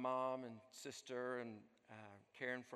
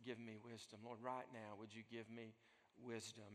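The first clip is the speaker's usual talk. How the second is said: soft, hushed plea to God; preacher voicing a whispered-down prayer, softly; quiet prayer; 9 dB quieter than usual. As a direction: pray it under your breath, humbly. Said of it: "that brief prayer".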